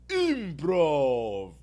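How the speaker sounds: "Improv" said the Spanish way, stretched and loud; Spanish pronunciation. Retold or imitated. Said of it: "all Spanish".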